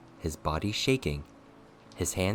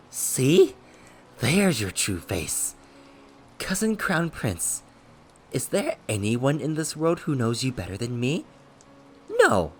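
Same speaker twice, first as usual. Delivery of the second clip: gloating rapture; 7 semitones higher, 6 dB louder than usual. A narrator's reading. Beaten villain gloating at cousin's true face, manic delight.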